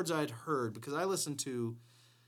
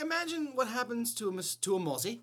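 mock Aussie rant; 8 semitones higher than usual. Continuing mock Aussie riff.

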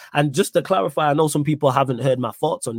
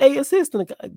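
surprised; 13 semitones higher, 3 dB louder than usual. Real, not acted. Surprised by a stat.

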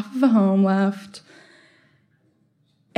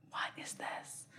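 barely breathed, dazed whisper; stunned whisper; 25 dB quieter than usual. Retold or imitated. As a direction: whisper it like you can't believe your eyes. Stunned on the sidewalk, retold.

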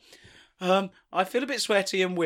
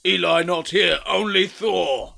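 loud, halting caveman grunts; caveman speak; 3 dB louder, slower than usual. Acted. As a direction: bark it out like a dim caveman, word by word. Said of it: "is it caveman speak now?".

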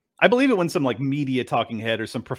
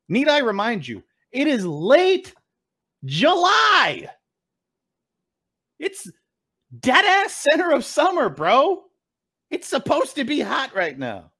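exasperated disbelief; 10 semitones higher, slower than usual. Real, not acted. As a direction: stretch the words, amazed anyone needs reminding. Exasperated rant about summer heat.

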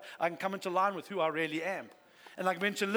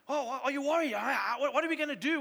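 higher, louder, half-laughing mock worry; mock panic; 7 semitones higher, 5 dB louder than usual. Anxious coworker asking a colleague about layoffs, hammed-up worry. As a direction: play up the fretting with a nervous laugh.

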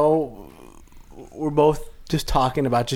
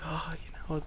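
whispered aside; 17 dB quieter, faster than usual. Retold or imitated. Retold line, whispered.

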